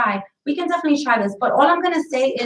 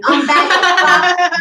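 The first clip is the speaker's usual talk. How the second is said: "Unbag it" shouted higher and louder; loud emphatic repeat; 5 semitones higher, 6 dB louder, much slower than usual. Real, not acted. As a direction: push "Unbag it" out loud and high.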